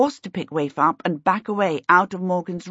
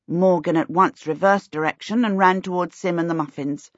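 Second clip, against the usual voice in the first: ordinary delivery, nothing marked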